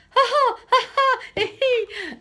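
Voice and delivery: very high, loud shrieking laugh; mother laughing on the floor; squealing tickled wail; wailing laughter. Acted. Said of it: "she wailed".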